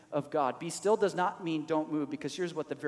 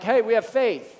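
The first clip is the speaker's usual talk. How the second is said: mock cheerful; 6 semitones higher, 10 dB louder than usual. Mock confident quote, loud.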